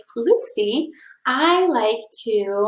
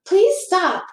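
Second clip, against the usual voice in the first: put-on higher child's voice, hurt and pleading; Lucy pleading; 9 semitones higher than usual. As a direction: beg the bully to stop, voice up high.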